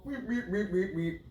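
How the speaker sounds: soft, quick run of "weep" noises; mimicked squeaking. Mocking partner hiding the phone, mimicked squeaking. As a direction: imitate a squeaky little noise, softly.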